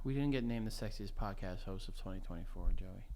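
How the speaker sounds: very hushed, low stage whisper. Pretend. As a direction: murmur it low under your breath. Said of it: "stop whispering".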